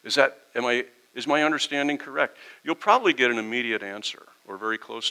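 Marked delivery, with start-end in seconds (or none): 0.0-2.4 mildly raised, curious asking; a hint of a genuinely confused churchgoer; calm honest question. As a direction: ask it openly, wanting to understand. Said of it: "if you come with that attitude of".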